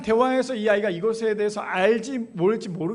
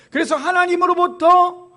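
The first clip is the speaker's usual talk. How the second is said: full-force preaching; 5 semitones higher, 6 dB louder than usual. Acted. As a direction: build to a fervent call on the congregation. Closing exhortation at full force. (높고 크게 외치는 축복의 마무리)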